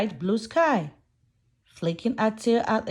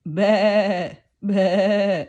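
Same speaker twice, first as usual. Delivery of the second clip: lamb bleating, a bit louder; playful bleat for children; sheep bleat; 3 semitones lower, 3 dB louder than usual. Pretend. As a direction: baa like a little lamb. Lamb in farm rhyme.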